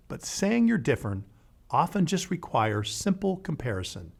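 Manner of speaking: usual speaking voice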